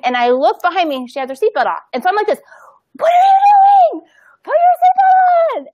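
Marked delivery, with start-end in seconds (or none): 3.0-5.7 high, loud, slow shout of alarm; yelling at her daughter. Retold or imitated. Mother yelling at her oldest daughter who unbuckled early, high slow shout. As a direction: shriek the seatbelt order. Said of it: "now our energy's here".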